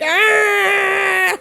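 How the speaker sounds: a high, loud imitated yelp; mock yell. Viewer mimicking Logan Paul's yelp in a mock yell. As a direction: belt out a hammed-up cry like the wrestler. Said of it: "He's just like".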